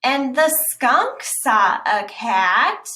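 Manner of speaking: slow, loud stress on "fox"; slow storybook emphasis; slow emphatic reading. Shown as a demonstration. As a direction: draw out "fox" and land "saw a cat". Reading italic lines slowly.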